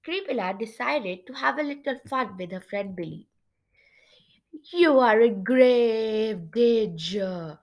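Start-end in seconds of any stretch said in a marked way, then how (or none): 4.7-7.6 very slow, drawn-out ghostly warning; louder, eerie haunting delivery; spooky voice. Acted. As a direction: stretch every word out spookily. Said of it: "she said in a spooky voice".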